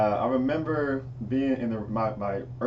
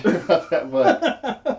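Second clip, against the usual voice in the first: laughing; 10 semitones higher, 7 dB louder, much slower than usual. Real, not acted. Both laughing at the story.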